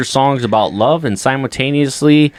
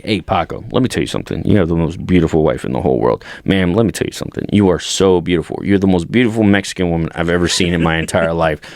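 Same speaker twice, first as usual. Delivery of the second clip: mock flattery; 7 semitones lower than usual. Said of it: "I was like".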